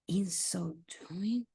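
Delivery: slow whispered try; whispering. Real, not acted. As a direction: whisper it slowly to yourself. Trying answer options under breath.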